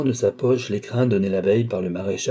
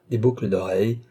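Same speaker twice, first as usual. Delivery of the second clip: plain reading voice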